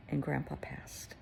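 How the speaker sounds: whispered, with sorrow; hushed grief. Real, not acted. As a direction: let the loss drop to a whisper. Sad news of grandpa's death.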